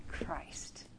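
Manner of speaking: breathy whisper of the name; awed whisper. Acted. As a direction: let the name drop to a hushed whisper. Awed reveal of the answer.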